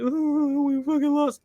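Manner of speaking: high-pitched wail imitating sore-losing kids; mock crying whine. Twelve-year-old teammates bawling after losing at soccer, mock crying whine. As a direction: whine it out like a crying child, mockingly. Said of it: "would cry".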